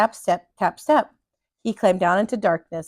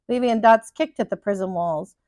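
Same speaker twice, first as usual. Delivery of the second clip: ordinary delivery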